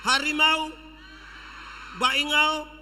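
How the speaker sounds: loud shouted call. Real, not acted. Preacher shouting to the congregation, loud call.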